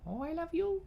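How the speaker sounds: high squeaky pet voice. Real, not acted. Cooing to her fox.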